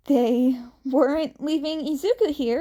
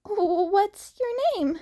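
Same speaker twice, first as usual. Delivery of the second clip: put-on high, earnest teen voice; Izuku, shy and friendly; 9 semitones higher than usual. A narrator's reading. Izuku explains himself to the stranger, high and earnest. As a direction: keep it light and eager.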